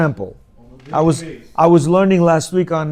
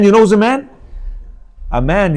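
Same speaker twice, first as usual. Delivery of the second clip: ordinary delivery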